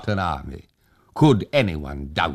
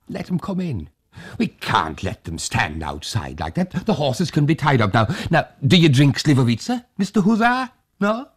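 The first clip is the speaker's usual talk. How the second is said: put-on high, fussing old man's voice; a fussing host pressing hospitality; old Tag, eager host; 8 semitones higher than usual. A narrator's reading. Old Tag urges the hussars inside, high and eager.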